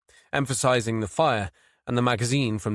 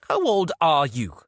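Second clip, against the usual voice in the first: prissy chiding; 4 semitones higher, 4 dB louder than usual. A narrator's reading. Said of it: "she chided him with a prissy shake".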